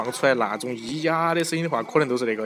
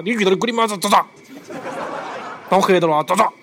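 excited; 7 semitones higher, 5 dB louder than usual. Real, not acted. Mocking reaction, laughing loudly.

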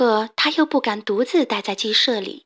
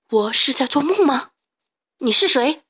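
fearful question; 3 semitones higher than usual. A narrator's reading. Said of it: "惶恐地问".

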